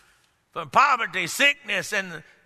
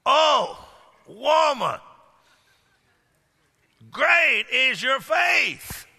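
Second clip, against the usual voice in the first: slow, ringing proclamation of praise; as Jesus, commending the woman's faith, slow and ringing; Jesus praising; 3 semitones higher, much slower than usual. Retold or imitated. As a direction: declare it slowly like Jesus commending her. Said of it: "And then Jesus said".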